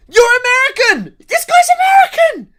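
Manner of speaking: high, loud exclaiming of American; disbelieving cry at the clip; real incredulous yelp; shocked outburst. Real, not acted.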